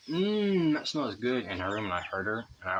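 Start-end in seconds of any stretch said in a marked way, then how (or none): 0.1-1.5 much higher, louder sing-song mmm of mom craving fish; delighted mom. Retold or imitated. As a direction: imitate mom suddenly happy at the smell of food. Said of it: "she was already going".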